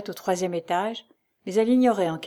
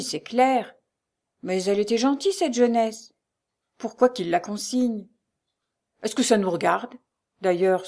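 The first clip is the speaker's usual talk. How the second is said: concierge couple bickering; 3 semitones higher, 4 dB louder than usual. A narrator's reading.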